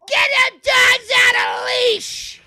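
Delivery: hammed-up angry old woman; mock old woman yell. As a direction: imitate a nagging old lady shouting across a park. Old woman yelling at him.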